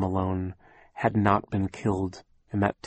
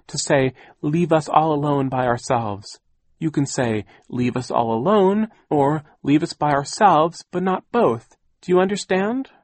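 higher, louder pedantic lecture; Aunt Josephine; 6 semitones higher, 5 dB louder than usual. A narrator's reading. Aunt lecturing children on grammar, pedantic. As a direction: spell out the rule like a strict grammarian.

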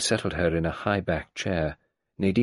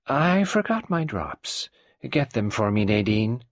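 put-on higher, suddenly sweetened old woman; changed tone; 5 semitones higher than usual. Mrs Boynton switching tone to send Nadine off. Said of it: "with a change of tone".

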